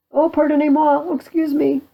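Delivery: high, pleading apology; acted apology. French soldier begs Pistol's pardon, high and apologetic. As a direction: beg pardon as a cowed captive.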